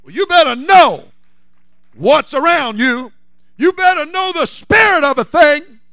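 loud, high, shouting sermon build; full-force preaching. Acted. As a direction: preach it hard to the congregation.

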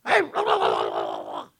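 silly babbling put-on voice; talking ear. Preacher pretends his ear is talking, silly babble. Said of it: "if your ear was talking".

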